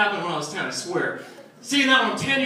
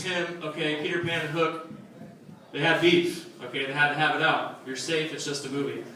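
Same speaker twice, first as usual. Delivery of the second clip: softer, lower calming dad voice; 6 semitones lower, 6 dB quieter than usual. Retold or imitated. A dad soothes his scared son at bedtime, softly. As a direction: talk him down gently, like a tired dad. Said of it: "my dad finally calms me down and says".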